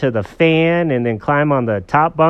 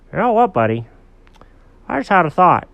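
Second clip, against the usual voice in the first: lightly voiced storybook dog, pitched up; louder, higher cartoon-dog buddy; dog character voice; 8 semitones higher, 5 dB louder than usual. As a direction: play an eager dog with an idea.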